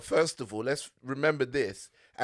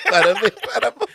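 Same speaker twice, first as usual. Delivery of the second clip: laughing; 6 semitones higher, 11 dB louder than usual. Retold or imitated. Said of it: "Do you do an Italian accent?".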